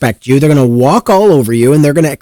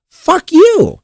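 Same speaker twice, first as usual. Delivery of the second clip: much higher, cracking mock outburst; mock exasperated shout. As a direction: throw it up high in disbelief, hammed-up. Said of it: "It's like".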